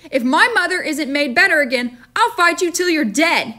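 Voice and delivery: put-on a furious child's threat; angry boy threat. A narrator's reading. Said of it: "John declared".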